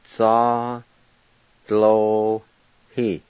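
"Za lo hi" split into separate syllables. Shown as a demonstration. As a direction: give "Za lo hi" one syllable at a time. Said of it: "I'll go back and pronounce them".

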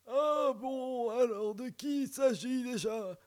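put-on high, slow old grandpa. Shown as a demonstration. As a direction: play it like a doddering grandpa. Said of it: "je vais faire comme mon papy".

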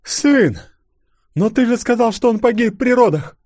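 high, loud cry of disbelief; shocked outcry. A narrator's reading. Mother told her son died at birth, shocked. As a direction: cry out high, confronting him.

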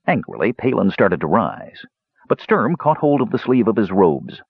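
plain reading voice

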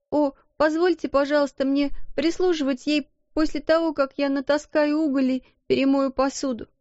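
put-on high, timid servant girl voice; frightened little maid. Maid explains to the headmistress, high and timid. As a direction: plead your way out, scared. Becky begging.

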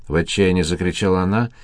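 ordinary delivery

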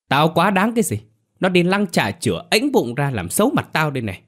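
very high, indignant shouting back; furious retort. Acted. Mother snaps back at son defending sister, high furious retort. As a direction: fling the words back at the son, outraged. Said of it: "vẫn còn hung hăng".

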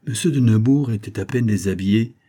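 plain reading voice

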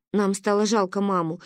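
ordinary delivery, nothing marked

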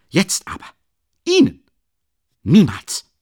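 very high, loud breaking cry; indignant outburst. Acted. Luschin weist Raskolnikow empört und schreiend ab. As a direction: explode on each word.

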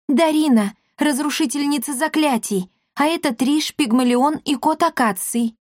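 put-on high-pitched timid girl; timid introduction. A narrator's reading. Darina naming companions to ghosts, timidly. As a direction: introduce everyone in a small, high voice.

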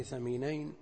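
ثمينين opened with TH as in "think". Shown as a demonstration. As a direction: put a TH, not an S, at the start of ثمينين. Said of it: "بالمثلثة بدل السين". (ثمينين بالثاء المثلثة لا بالسين)

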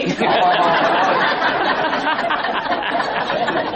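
a long, real laugh at his own joke; long amused laugh. Real, not acted. Monk laughing at the student's blunder, a long laugh. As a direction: let the laugh roll out and fade.

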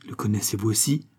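ordinary delivery, nothing marked

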